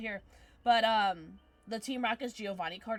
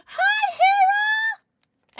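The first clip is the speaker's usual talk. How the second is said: very high, drawn-out squeal; loud squealed hello, stretched out; excited dog greeting; 16 dB louder, much slower than usual. Real, not acted. Streamer saying hi to their dog, excited squeal.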